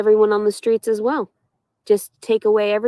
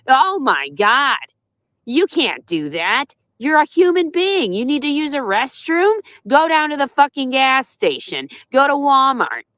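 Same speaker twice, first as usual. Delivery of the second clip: mock scandalized onlooker; 6 semitones higher than usual. Mocking people who shame her restroom use, in a high scandalized voice. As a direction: parody a shocked, preachy stranger.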